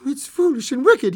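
high, loud, agitated protest; faltering protest. Acted. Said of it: "he faltered".

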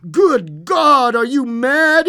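high, loud horrified shout; aghast. A narrator's reading. Husband horrified by wife's demand, aghast. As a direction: cry out, utterly appalled. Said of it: "he cried, aghast".

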